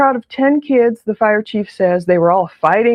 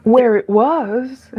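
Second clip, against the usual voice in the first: slow hesitant quote; much slower than usual. Retold or imitated.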